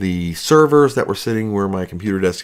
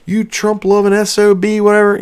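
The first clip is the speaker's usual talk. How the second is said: higher mock outrage of a hater; mocking angry commenter; 10 semitones higher than usual. Mocking hateful commenters about his hat, higher-pitched outrage. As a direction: whine it like an offended YouTube commenter. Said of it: "comments ... smashing me".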